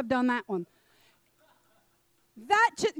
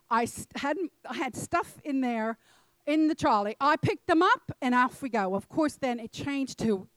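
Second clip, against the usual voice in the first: ordinary talk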